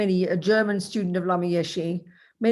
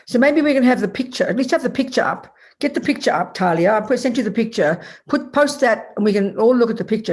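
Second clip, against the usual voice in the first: raised voice, higher, calling to a helper; 4 semitones higher, 6 dB louder than usual. Real, not acted. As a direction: call across the room for the picture. Calling out instructions to assistant.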